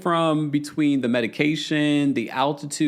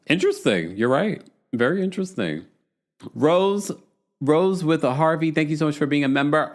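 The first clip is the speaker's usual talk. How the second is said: ordinary delivery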